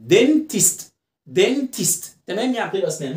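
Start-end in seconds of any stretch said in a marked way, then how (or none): 0.1-2.0 "dentist" split as "den-tist", raised pitch; "dentist" with open e, short i; two-syllable demo. Shown as a demonstration. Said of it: "مقطعين".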